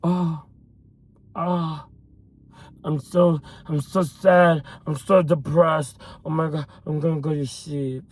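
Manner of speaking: mope drowsily, mocking self-pity; whiny depressed person. Imitating a follower messaging about being depressed, mock mopey. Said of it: "another message of somebody telling me".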